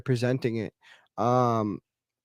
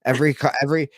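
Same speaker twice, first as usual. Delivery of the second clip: usual speaking voice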